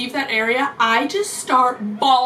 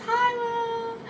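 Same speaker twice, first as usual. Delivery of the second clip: very high, soft singsong hello; drawn-out, softened and pitched way up; 11 semitones higher, 6 dB quieter than usual. Retold or imitated. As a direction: imitate the singer's dainty greeting. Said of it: "she has such a soft voice".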